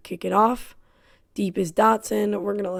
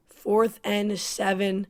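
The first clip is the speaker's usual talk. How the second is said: normal talking voice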